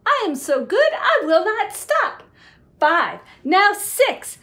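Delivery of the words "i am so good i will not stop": ordinary delivery, nothing marked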